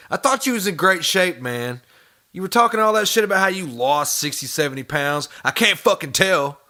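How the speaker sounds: high, pushy mock outrage at the streamer; jeering at the streamer about his weight; mocking taunt. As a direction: needle him like he's right there. Host taunts the streamer directly.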